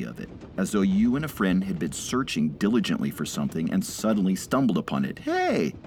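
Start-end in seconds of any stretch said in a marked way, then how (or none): 5.2-5.7 very high, singing Hey; joyous lilting call. A narrator's reading. Old man calling delightedly to the drunk. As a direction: call it out as if you found a treasure. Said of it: "strangely joyous, lilting quality".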